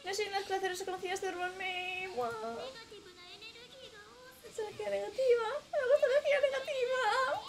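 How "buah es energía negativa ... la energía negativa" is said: mock whimper. Mock-crying with creature.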